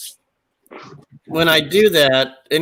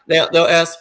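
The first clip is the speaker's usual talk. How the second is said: usual speaking voice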